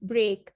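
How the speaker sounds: ब्रेक said firmly, ay glide clear; demo word. Shown as a demonstration. Teacher repeating demo words for practice, firm. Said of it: "आप लोग ज़ोर डालें".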